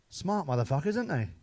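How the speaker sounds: ordinary talk, nothing marked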